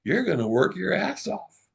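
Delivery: high, gleeful threat; playful threat. Retold or imitated. Boss teasing the employee, high and gleeful. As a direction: tease her with mock sternness. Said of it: "And I said".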